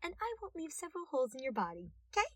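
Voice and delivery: a cute threat, almost whispered at first; playful dangerous deal; sweetly threatening detective. A narrator's reading. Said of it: "He suggested".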